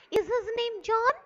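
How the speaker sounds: high question rising on "John"; rising intonation. Shown as a demonstration. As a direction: lift "John" as a yes-no question.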